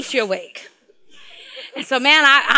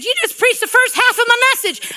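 high-pitched, raised mock yell; comically indignant high holler; retold yell; 11 semitones higher, 3 dB louder than usual. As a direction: yell it in pretend outrage, pitched way up. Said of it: "yelled at me and said".

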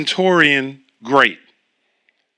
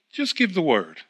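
"just give the word" said softer and settled, unhurried; soft calm request; 7 dB quieter than usual. Retold or imitated. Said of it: "he seemed to calm down".

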